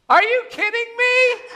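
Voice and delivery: very high, loud disbelief; can't believe what he just heard; incredulous. Acted. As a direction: hiker told to let go, in loud disbelief. Hiker reacts to God's advice.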